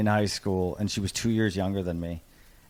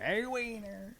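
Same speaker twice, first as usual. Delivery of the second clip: taunting nickname call; 11 semitones higher, 9 dB quieter, slower than usual. Retold or imitated. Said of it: "So you got used to Wiener".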